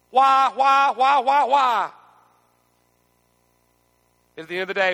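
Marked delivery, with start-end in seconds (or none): 0.1-1.9 loud, high anguished repeated why; crying out why. Acted. Preacher voices the congregation's anguish, loud pleading cry. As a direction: cry each why out to heaven.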